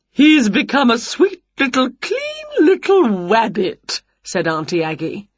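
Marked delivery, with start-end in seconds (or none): 0.1-4.0 high, sing-song aunt voice; cooing aunt. A narrator's reading. Aunt presents the new rabbit, high cooing voice. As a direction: coo it, stretching the end high.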